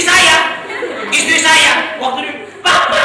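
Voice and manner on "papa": put-on high child's call from behind. Child on camel calls father, imitated high. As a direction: imitate the kid crying Papa.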